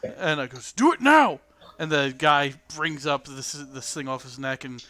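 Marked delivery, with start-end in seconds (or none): 0.7-1.4 high, loud barked command; acted guard's shout; shouted order. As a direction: demand it at once.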